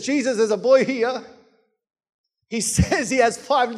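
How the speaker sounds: telling Jesus with a laugh breaking in; mild amused scoff, chuckle mid-line; laughing Philip. Retold or imitated. Philip laughing to Jesus about the boy's five loaves. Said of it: "You can just see him laughing".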